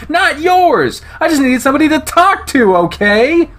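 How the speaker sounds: high, loud furious shout; angry outburst. Acted. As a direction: let Chase explode at him, voice pitched high. Chase snaps at Leo.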